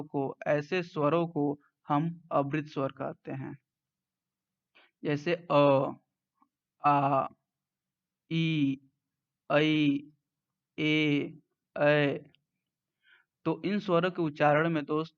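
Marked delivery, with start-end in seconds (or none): 5.5-12.2 "अ, आ, ई, ऐ, ए, ऐ" each held with spread lips; unrounded vowel demonstration. Shown as a demonstration. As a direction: sound out "अ, आ, ई, ऐ, ए, ऐ" one by one, lips unrounded. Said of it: "ओष्ठ गोलाकार न होकर फैले".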